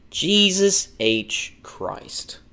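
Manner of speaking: very slow, starting high and falling; drawn-out exasperation. Real, not acted. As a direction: stretch each word out in exasperation. Exasperated repeat at dead console.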